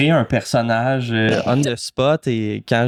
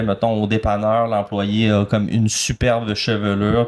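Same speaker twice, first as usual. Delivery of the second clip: usual speaking voice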